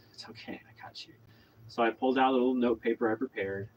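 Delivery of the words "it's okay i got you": very soft, whispered comfort; retold soothing whisper; hushed reassurance. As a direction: reassure them under your breath. Retold reassurance to stressed artist.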